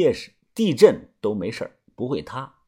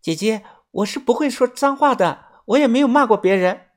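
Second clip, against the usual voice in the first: lightly voiced innocent girl; 9 semitones higher than usual. A narrator's reading.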